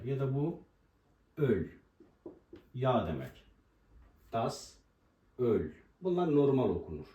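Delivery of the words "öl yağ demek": ordinary delivery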